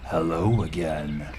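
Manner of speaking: low, slow, sinister greeting; creepy clown voice. A narrator's reading. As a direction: drawl it like a menacing clown.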